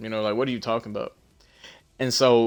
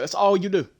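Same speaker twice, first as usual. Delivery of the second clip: heated retort; 8 semitones higher than usual. Retold or imitated. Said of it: "I'm explicit with it".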